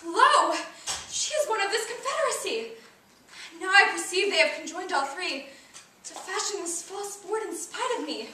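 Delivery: theatrical, wounded outrage; indignant accusation. Acted. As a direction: accuse a friend who has turned on you. Acted quarrel, accusing Hermia.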